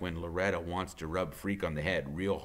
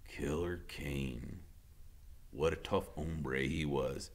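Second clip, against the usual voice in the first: low menacing; 4 semitones lower, 8 dB quieter, slower than usual. A narrator's reading. Iggy musing.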